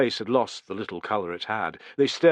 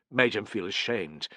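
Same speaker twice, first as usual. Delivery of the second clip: usual speaking voice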